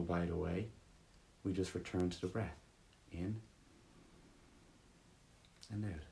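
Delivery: easy, unforced guiding voice with room to breathe; guided meditation. Real, not acted. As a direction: guide listeners gently back to the breath, leaving long pauses.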